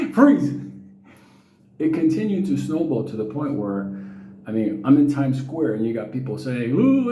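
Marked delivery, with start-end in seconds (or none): 0.1-0.6 high, louder barked command; mock cop shout. 6.8-7.1 high, loud call across a crowd; calling his name. Retold or imitated. People calling him in Times Square.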